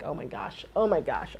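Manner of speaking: low, soft retold muttering; hushed dread. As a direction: mutter it to yourself in mock dread. Retelling own sweating dread under breath.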